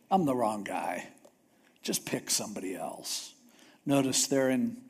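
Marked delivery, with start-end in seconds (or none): 0.1-3.3 lower, softer, giving up; defeated Moses. Retold or imitated. Moses pleading with God, deflated and quiet. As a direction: mumble it like a beaten man.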